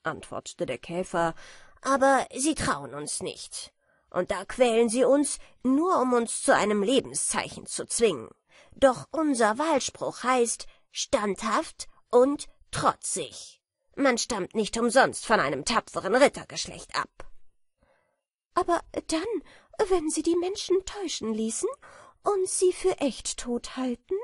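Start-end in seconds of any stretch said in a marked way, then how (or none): 1.8-17.3 louder, self-important beetle voice; a smug know-it-all beetle teasing; lightly voiced boastful beetle; proud beetle. A narrator's reading. Beetle declares motto. 18.5-24.2 put-on high, light, dreamy girlish voice; a naive little butterfly imagining honey; sweet and high; innocent butterfly. A narrator's reading. Butterfly objects.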